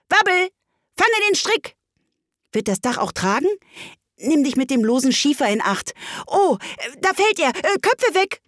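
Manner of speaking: high excited animal voices; animals on the roof. Acted. Animals shout up on the roof, high and excited. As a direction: call out to each other, high and hurried.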